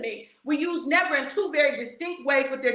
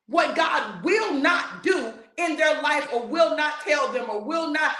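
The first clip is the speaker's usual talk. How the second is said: usual speaking voice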